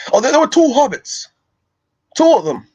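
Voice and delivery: high, strained dwarf voice; Gimli. Acted. Streamer quoting the dwarf about two hobbits, Gimli voice. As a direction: cry it out high as an excited dwarf. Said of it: "I can do my Gimli voice".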